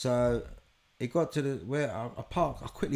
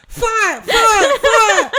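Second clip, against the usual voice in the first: very high, loud hammed-up yells; comic high-pitched shouting; frantic shouting; 15 dB louder, much slower than usual. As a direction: shriek each word like an alarm. Acted frantic shouting in story.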